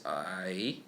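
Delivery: low hoarse I, dipping then rising; hoarse third tone I. Shown as a demonstration. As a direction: rasp out I low and curl it up. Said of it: "With some hoarse voice".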